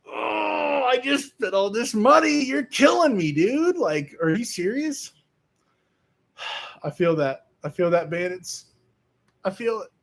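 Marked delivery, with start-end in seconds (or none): 0.1-5.1 higher, mock-pained cry of frustration; a buyer moaning over parts that failed; exasperated disbelief. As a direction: whine it like you just got ripped off. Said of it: "And you're just like".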